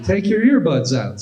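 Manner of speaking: high, pushy stranger; stranger demanding. Retold or imitated. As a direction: order the earbuds out. Stranger barks order.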